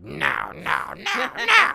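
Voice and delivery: high yapping no no no; yappy little dog. Retold or imitated. Voicing a tiny dog yapping at a stranger, high and yappy.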